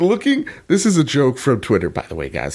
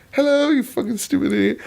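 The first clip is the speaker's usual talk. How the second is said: mock polite insult; 14 semitones higher than usual. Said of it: "I'm like".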